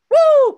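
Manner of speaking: high, loud party whoop; loud excited show-opening whoop; hype whoop. Acted. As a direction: whoop to pump up the crowd. Host cheers before the merch plug.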